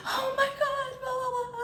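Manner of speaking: high, wailing mock sob; imitated crying. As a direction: mimic a girl crying on the phone, very high. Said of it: "calls me crying on the phone".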